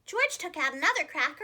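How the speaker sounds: ordinary delivery, nothing marked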